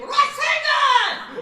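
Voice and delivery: high, very loud, drawn-out scream; full-volume video game battle yell; screamed battle cry. Shown as a demonstration. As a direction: scream it like an anime attack.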